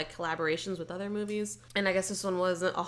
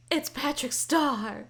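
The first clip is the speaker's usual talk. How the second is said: slow, higher-pitched fan excitement; retold excitement; 7 semitones higher, much slower than usual. Podcaster re-enacts spotting a familiar actor, excitedly. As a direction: draw it out like a thrilled fan. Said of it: "I was so excited".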